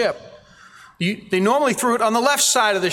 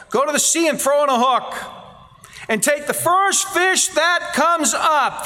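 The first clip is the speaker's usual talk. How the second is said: higher, emphatic quote; 8 semitones higher than usual. Retold or imitated. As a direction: lift the command up like a proclamation.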